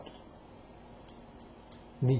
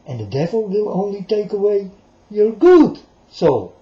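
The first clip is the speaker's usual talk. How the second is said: raised voice on "good soul"; loud warning; 5 semitones higher, 6 dB louder than usual. Real, not acted. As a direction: push the warning out, higher. Warning about the devil, raised.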